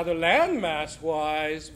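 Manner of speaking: ordinary talk, nothing marked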